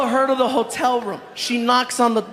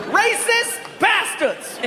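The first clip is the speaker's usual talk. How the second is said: high, slow offended grumble; mock-offended grumbling through a door; hammed-up indignant G; muttered insult; 9 semitones higher, much slower than usual.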